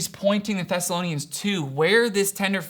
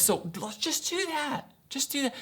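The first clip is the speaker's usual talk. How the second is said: higher, softer imitated shrug-it-off suggestion; offhand advice; 7 semitones higher, 8 dB quieter than usual. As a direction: toss it off as someone's easy answer. Voicing glib practical advice.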